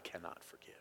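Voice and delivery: hushed, grave whisper. Real, not acted. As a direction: drop to a barely audible whisper. Preacher drops to near silence.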